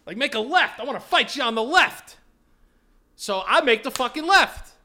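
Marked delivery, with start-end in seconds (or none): 0.2-2.2 high, loud mock challenge; imitated angry challenger. Imitating driver challenging me from his car, mock yelling. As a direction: call it out like a hyped-up driver. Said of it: "he's still doing this".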